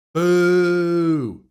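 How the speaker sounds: loud, drawn-out boo; high, sustained heckle; jeering boo. Real, not acted. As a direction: boo the screen long and loud. Jeering at the film's scene.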